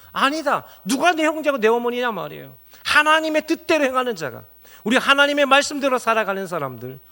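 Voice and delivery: loud, high impassioned sermon; a pastor's fiery call from the pulpit; fervent preaching. Acted. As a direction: preach it out with full force, rising high. Preaching at full force.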